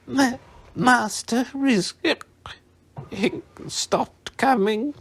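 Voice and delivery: high, slow, slurred elf squeak; drunk house elf. A narrator's reading. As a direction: stammer it out, tipsy and shocked.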